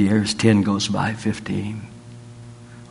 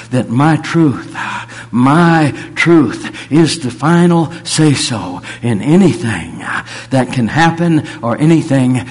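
preaching at full force; 3 semitones higher, 6 dB louder than usual. Acted. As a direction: proclaim it like a pulpit climax. Preaches in God's voice.

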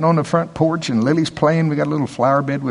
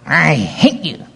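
put-on rebellious teen; 7 semitones higher than usual. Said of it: "that wonderful rebellious stage".

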